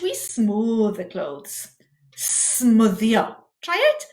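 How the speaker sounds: ordinary delivery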